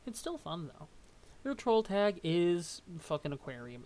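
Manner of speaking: normal talking voice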